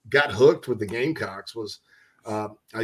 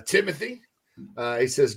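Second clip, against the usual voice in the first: usual speaking voice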